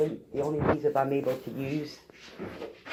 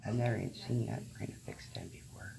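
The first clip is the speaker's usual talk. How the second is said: whispering; 3 semitones lower, 10 dB quieter than usual. Real, not acted. Whispering in dental office.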